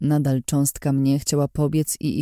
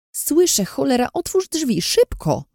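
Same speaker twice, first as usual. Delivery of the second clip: high, urgent and pressing; 11 semitones higher, 3 dB louder than usual. Acted. Narrator's companion urging her to open the door, high urgent call. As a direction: hurry them to open the door.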